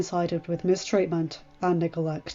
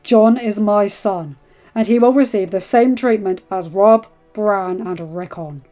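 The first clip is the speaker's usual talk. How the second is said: losing patience; 9 dB louder than usual. A narrator's reading. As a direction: lay down the law, out of patience. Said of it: "losing his patience".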